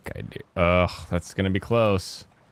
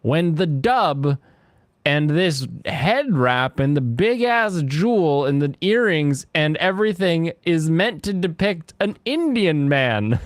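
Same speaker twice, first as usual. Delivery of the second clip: ordinary talk, nothing marked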